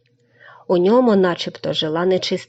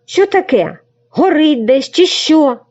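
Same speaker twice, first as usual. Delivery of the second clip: startled; 7 semitones higher, 4 dB louder than usual. Acted. Crane jolted awake, alarmed.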